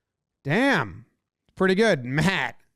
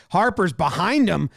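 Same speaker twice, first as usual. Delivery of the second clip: ordinary talk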